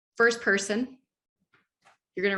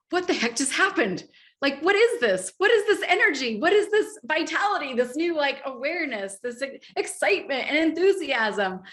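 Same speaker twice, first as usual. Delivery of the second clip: higher, louder, astonished questions; amazed subconscious; 5 semitones higher, 4 dB louder than usual. Pretend. The subconscious reacting to enthusiasm, astonished and excited. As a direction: marvel at sudden new energy. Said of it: "your psyche is like".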